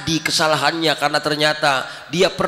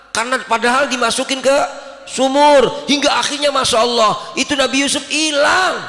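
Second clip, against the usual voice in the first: rising preaching shout; 7 semitones higher than usual. Acted. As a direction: build to a full pulpit cry. Preacher climaxes the story. (seruan khotbah yang memuncak)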